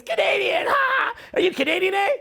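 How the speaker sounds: very high, gleeful mocking squawk; hammed-up high-pitched taunting; mocking teasers. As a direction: jeer in a silly high voice. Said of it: "everyone goes".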